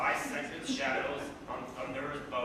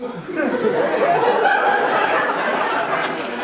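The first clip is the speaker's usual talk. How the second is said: laughing; 12 semitones higher, 16 dB louder than usual. Real, not acted. Laughs at scrotum beasts.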